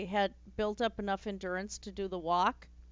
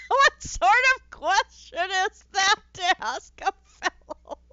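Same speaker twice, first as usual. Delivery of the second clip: high, loud, laughing through the words; real squealing laughter breaking up the line; helpless laughter; 9 semitones higher, 11 dB louder than usual. Real, not acted. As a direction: read it out while cracking up. Cracks up reading the game line.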